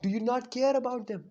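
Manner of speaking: high, loud furious yell at his friend; angry shout. Retold or imitated. Sasuke, after punching Naruto, shouts at him in anger. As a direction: yell the accusation, voice cracking high.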